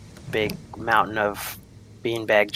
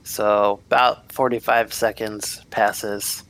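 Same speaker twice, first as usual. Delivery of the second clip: ordinary delivery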